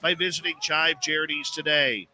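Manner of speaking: measured, projected radio host opening; radio show intro. Acted. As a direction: welcome listeners around the world like a radio announcer. Radio host opens show over music.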